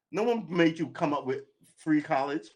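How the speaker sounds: usual speaking voice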